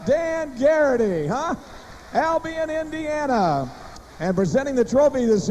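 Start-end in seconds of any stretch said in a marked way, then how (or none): 0.1-3.7 big arena announcer call; rally the crowd for applause. Acted.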